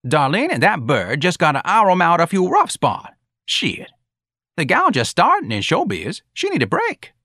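high-pitched, swinging drawn-up tune; animated character voice. Acted. Standing up for the gal starting in showbiz, animated. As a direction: plead the new girl's case, pitch up high.

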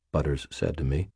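ordinary delivery, nothing marked

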